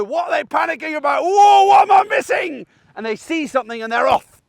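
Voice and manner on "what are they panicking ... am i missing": high, loud, slowing mock dog alarm. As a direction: voice the dog spooked by the owner's panic. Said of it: "makes the dog go".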